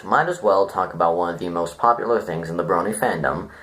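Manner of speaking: normal talking voice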